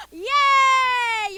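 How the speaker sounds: loud delighted cheer; gasp, then cheer loudly; excited gasp. Real, not acted. Cheering kids' right answer.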